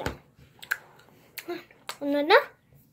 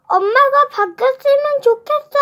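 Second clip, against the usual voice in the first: loud, much higher sulky shout; loud cry; 11 semitones higher, 7 dB louder than usual. Acted. 준혁 shouting at mom that he wants a new one. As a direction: yell it out in a pout. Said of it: "큰 소리로 외쳤어요".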